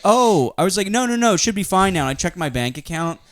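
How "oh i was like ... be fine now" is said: higher, flustered denial; flustered surprise. Retold or imitated. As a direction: brush it off, caught out.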